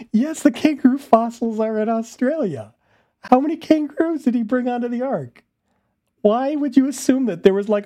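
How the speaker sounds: loud, high-pitched disbelief at the screen; incredulous rant. Real, not acted. Reacting to a video, loud incredulous rant. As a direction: push it up and out like you can't believe it.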